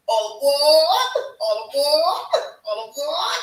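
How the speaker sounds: high, loud all aboard calls; train call. Acted. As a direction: shout it like a conductor calling. Train chant call with crowd.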